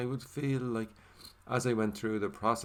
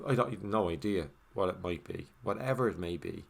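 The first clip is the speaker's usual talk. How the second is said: ordinary delivery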